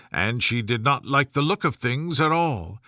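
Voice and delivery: ordinary delivery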